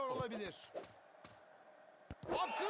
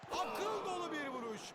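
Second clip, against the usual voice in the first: ordinary talk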